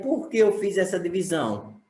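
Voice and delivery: ordinary delivery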